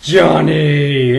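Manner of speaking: a hint of a childish shout; lightly voiced kid. The escaped kid in the garage, calling out. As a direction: call your name like a lost kid. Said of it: "And you hear".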